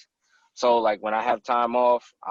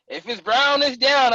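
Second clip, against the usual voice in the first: higher, louder mock hunting-camp voice; camp buddies' rule; 11 semitones higher, 6 dB louder than usual. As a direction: toss off the camp motto, hammed up. Hunting camp guys quoted.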